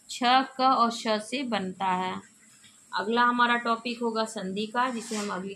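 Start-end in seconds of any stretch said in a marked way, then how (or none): 0.1-2.2 slow, letter by letter; slow explanation. Shown as a demonstration. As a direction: spell out each letter slowly. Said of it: "शुद्ध उच्चारण कक्षा है".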